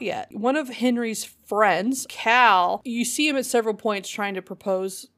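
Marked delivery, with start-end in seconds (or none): none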